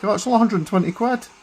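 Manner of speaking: put-on high, excited child pitch; eager kid voice. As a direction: imitate an eager young son. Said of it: "he's like".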